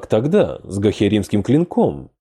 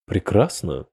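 usual speaking voice

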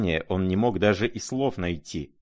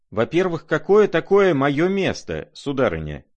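high, raised-pitch challenge; indignant retort; 8 semitones higher, 6 dB louder than usual. A narrator's reading. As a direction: throw the slight back, pitched well up. Stung retort in a quarrel.

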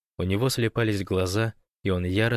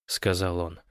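usual speaking voice

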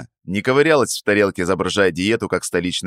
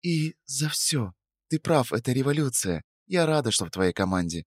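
whisper; 6 semitones higher, 7 dB quieter than usual. A narrator's reading. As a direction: murmur close and tender. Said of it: "шепнула она".

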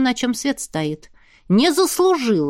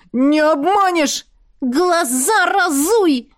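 shouting; 6 semitones higher, 3 dB louder, slower than usual. Acted. Shouted quarrel.

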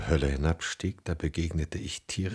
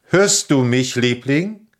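much higher and much louder, calling across the house; 9 semitones higher, 12 dB louder than usual. Acted. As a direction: call to her from far away. Calling out to his wife.

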